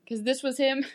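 ordinary delivery

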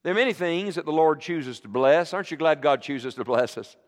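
ordinary talk

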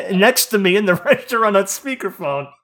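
high, loud, laughing disbelief; incredulous laughter. Real, not acted. Telling a friend about the speakerphone call, laughing incredulously.

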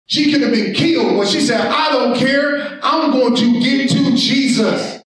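driving, urgent pulpit delivery; impassioned sermon delivery; full-force preaching. Acted. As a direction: preach it hard to the congregation.

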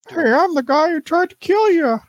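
high, silly puppet voice; puppeted would-be killer. Pretend. Player working a held-up man like a puppet, high mock voice. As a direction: pipe up as a mock puppet figure.

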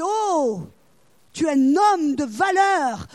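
loud, slow, drawn-out hail; angelic proclamation. Acted. Angel appearing to Gideon to hail him, loud proclamation. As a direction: proclaim it grandly, stretching the words.

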